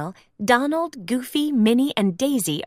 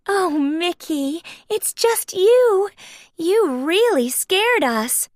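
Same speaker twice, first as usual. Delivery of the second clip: high, slow relieved Minnie voice; 7 semitones higher, slower than usual. Acted. Minnie relieved the monster is Mickey. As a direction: sigh out relief.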